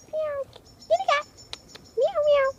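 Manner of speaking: high coaxing pet call; sing-song call to lure the cat; calling kitty. Real, not acted. Calling the cat over in a high coaxing voice.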